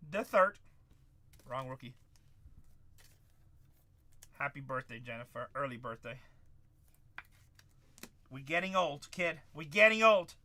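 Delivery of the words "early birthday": normal talking voice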